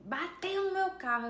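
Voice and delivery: high, sharp accusing voice; retold angry man snapping an accusation; accusing shout. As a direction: throw the blame at her like the angry driver. Man accusing her from his car.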